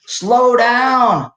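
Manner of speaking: sharp high call to slow down; coach yelling. Retold or imitated. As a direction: shout it like a coach from the sideline. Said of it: "my coach yells at me".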